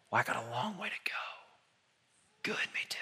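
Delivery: soft confiding whisper; whispered aside. Real, not acted. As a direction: lean in and whisper it. Intimate aside to congregation.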